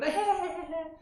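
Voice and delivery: high mock giggle; imitated tickling giggle. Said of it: "they, like, tickle their children".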